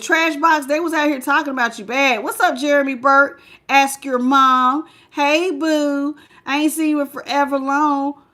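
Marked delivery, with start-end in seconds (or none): none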